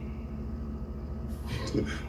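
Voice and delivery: quiet, breathy snicker; soft, mocking little laugh; derisive chuckle. Real, not acted. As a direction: laugh softly at his foolishness. Laughs at man sounding stupid.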